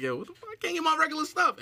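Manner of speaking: rising to a high, indignant complaint; mock frustrated buyer. Imagining the sold-out remedies, high mock outrage. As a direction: play yourself annoyed the stuff sold out. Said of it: "It's like".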